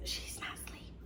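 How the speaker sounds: unvoiced hushed whisper. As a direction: whisper it so the patient won't hear. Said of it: "she whispers".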